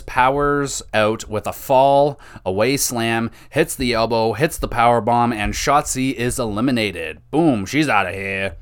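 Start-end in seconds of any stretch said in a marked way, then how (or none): none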